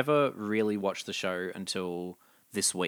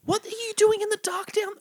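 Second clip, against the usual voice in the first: much higher, baffled squeak; startled disbelief. Retold or imitated. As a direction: ask it like you just got a fright. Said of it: "and I'm like".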